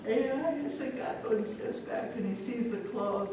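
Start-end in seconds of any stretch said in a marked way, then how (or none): 0.1-1.3 high-pitched acted worry; growing fear. Father seeing the cat's teeth, growing fear. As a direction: back away nervously in a raised pitch.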